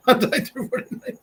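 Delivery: high, breaking into laughter; laughed-out, high-pitched words; laughing. Real, not acted. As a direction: repeat it through real laughter. Laughing at own line.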